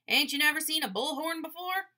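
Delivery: loud, high mock bullhorn call; bullhorn blare. A narrator's reading. Al demonstrates his bullhorn to the boys, blaring loudly. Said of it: "sudden blare of noise".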